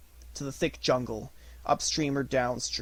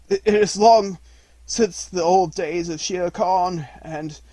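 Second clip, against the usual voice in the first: coughing; 5 semitones higher, 8 dB louder than usual. A narrator's reading. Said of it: "coughed Akela".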